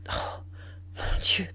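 breathless, barely voiced murmur. A stunned mistress murmurs to herself in a daze. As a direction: let it slip out under the breath, stunned. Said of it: "Puis elle murmura".

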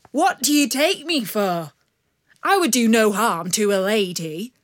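playful wounded honour; gallant outlaw. Acted. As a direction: bandit leader protesting to the father, loud and gallant. Bandit leader protests gallantly.

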